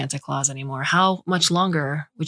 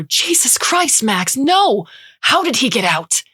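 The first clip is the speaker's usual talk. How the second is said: high, loud panicked cry at the dog; panicked shout; 8 semitones higher, 5 dB louder than usual. A narrator's reading. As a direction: yell it in sudden alarm. Mother bursts out, dog loose.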